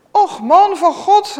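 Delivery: a high, loud, slow cry of disbelief; woman calls out to the prophet in disbelief. Acted. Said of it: "riep ze".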